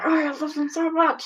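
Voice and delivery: high, breathless lovesick gush; mock lovesick teen voice; gushing young lover. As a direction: swoon over them like a teenager in love. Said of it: "young lovey".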